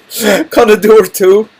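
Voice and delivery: a hammed-up threat, laughing; threatening question. Shown as a demonstration. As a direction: lean in with mock menace, drawn out. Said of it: "this isn't necessarily a threat, but it could be".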